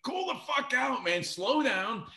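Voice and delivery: high, urgent plea to slow down; excited friend. Retold or imitated. Friend holding him back from running to Ozzy, high urgent. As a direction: rein in your buddy, pitch up.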